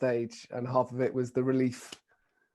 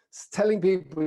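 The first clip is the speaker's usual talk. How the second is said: ordinary talk, nothing marked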